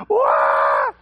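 piercing imitated baby cry; screaming baby imitation. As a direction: cut in with a baby's howl. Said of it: "like, screaming".

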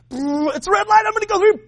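high-pitched, excited driver blurt; hammed-up panicky high voice; mock reckless driver. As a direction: squeal it like you're gunning through the light. Acts driver running red light.

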